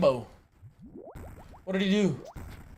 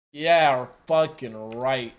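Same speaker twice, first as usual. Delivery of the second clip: normal talking voice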